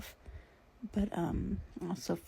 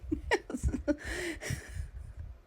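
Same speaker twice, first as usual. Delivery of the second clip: high, breathless burst of laughter; laughing to not cry; 10 semitones higher than usual. Real, not acted. Joking about the day count, high laughter. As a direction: laugh so you don't cry. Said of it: "I have to laugh to not cry".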